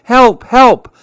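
high, loud cries for help; big monkey yelling high in alarm; panicked shout. Acted. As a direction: call out in fright.

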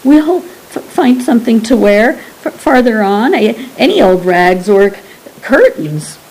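stammering with cold; teeth chattering. Acted. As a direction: shiver through the words. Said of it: "Tim's teeth chattered".

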